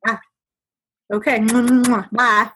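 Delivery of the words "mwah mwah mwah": blown kisses. Real, not acted. Blowing kisses at sign-off.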